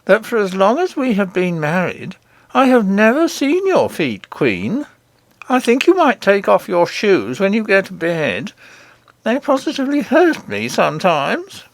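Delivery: high, put-on husband voice; complaining goblin king. A narrator's reading.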